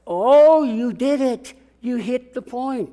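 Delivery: high, loud burst of delight; excited praise. Real, not acted. Preacher praising the listener who got it, excited. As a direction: cheer the right answer. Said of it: "praising a listener's answer".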